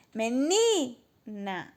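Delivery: very slow "Menina" with a long, rising "ni"; drawn-out call of "Menina" after a giggle; calling at the gate. Shown as a demonstration. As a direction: stretch the "ni" of "Menina" as if calling someone. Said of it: "Vamos chamar no portão".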